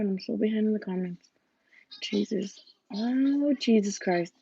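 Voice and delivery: soft whispered lament. Pretend. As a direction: whisper it to yourself, dismayed. Fretting over missed comments.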